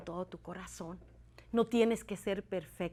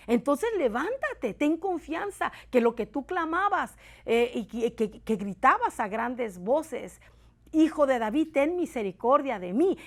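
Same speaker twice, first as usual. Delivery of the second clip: rising, louder preaching, pleading call; fervent preaching; 5 semitones higher, 7 dB louder than usual. Acted. Preacher urging viewers to rise, fervent rising plea. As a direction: build the plea into a cry. Preacher retells Bartimaeus story.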